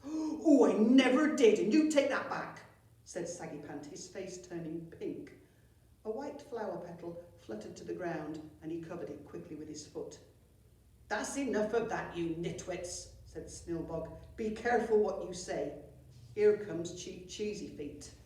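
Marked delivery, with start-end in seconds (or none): 0.1-2.6 high, loud outraged goblin; shrieking indignant denial. Acted. Twin denies, outraged. 11.1-18.1 scolding goblin. Acted. Snilbog scolds twins.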